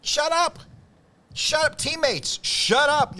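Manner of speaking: high, loud "shut it" outburst; exasperated scolding. Acted. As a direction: yell at noisy sideline coaches. Scolding fans who yell instructions.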